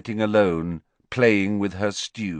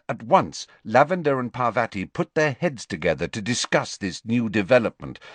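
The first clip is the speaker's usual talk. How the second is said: plain reading voice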